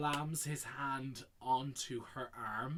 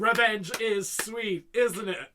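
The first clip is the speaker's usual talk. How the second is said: higher, louder theatrical relish; mock gloating; 5 semitones higher, 8 dB louder than usual. Fan relishing Janet's revenge, mock gloating. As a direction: crow it like a villain.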